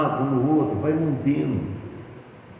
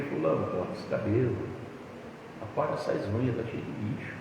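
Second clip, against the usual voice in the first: gentle coaxing; 9 dB quieter than usual. Retold or imitated. Gentle advice to husband.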